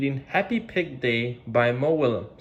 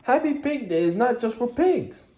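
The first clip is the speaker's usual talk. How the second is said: reassuring; 10 semitones higher than usual. Acted. Piggy comforting Gerald.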